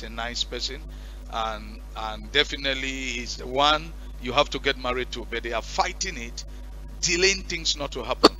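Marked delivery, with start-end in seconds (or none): none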